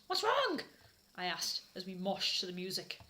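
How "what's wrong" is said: put-on concerned girl. Asking sad Draco.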